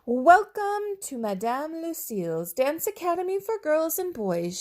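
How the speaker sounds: high, grand welcome; teacher welcoming the dinosaur, high voiced; teacher's welcome. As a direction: announce it high like a grand lady.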